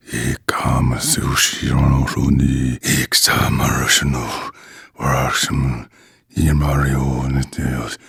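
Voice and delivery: hushed arcane chant; low murmured incantation. Acted. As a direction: intone the spell in a steady low murmur. Long spell incantation.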